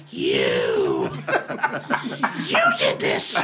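hammed-up blaming yell; mock fury at the culprit; mock accusing shout. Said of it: "he goes".

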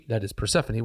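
normal talking voice

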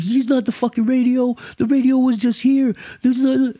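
high, squeaky mock-tantrum voice; manager flipping out. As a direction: imitate a little manager freaking out in a high pitch. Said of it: "this little dude who's a manager is flipping out".

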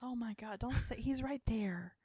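whisper. Real, not acted. Friend hushing, man nearby.

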